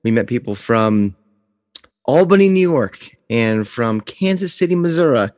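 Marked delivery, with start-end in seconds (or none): none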